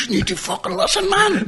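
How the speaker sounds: high, laughing through words. Real, not acted. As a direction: crack up while scolding. Laughing at himself.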